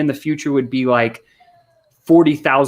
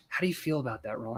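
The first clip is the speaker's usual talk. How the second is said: hushed, much softer ask; soft feelings question; 2 semitones lower, 15 dB quieter, faster than usual. Shown as a demonstration. Salesman demonstrates gently asking Ron how he feels. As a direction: drop to a near-hush to draw him out. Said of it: "So we're saying".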